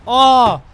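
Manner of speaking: loud, high outraged cry; shouted oh. Real, not acted. Gamer reacting to a failed run, outraged shout. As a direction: yell it out in disbelief. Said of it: "I'm sorry for shouting at you".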